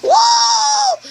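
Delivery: shrieking whoa, very high; high UFO whoa. Pretend. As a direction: squeal it like a UFO. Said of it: "things from outer space that go".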